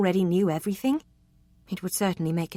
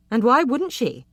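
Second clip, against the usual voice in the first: usual speaking voice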